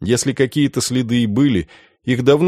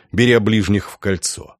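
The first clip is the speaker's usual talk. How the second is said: plain reading voice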